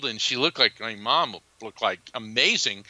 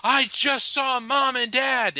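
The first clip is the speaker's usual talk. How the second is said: much higher and slower, breathless with wonder; excited announcement; 12 semitones higher, 3 dB louder, slower than usual. Retold or imitated. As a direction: blurt the news up high, amazed. Bursting in to tell brother.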